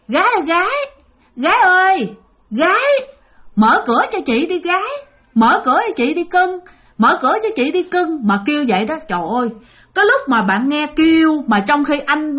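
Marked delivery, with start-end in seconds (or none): 0.1-8.3 high, drawn-up voice of a ghost woman calling; ghostly coaxing call. Retold or imitated. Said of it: "không phải kêu nhẹ nhàng như mới nãy". (giọng ma nữ gọi cửa cao, dỗ ngọt)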